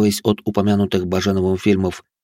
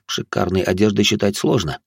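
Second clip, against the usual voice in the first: plain reading voice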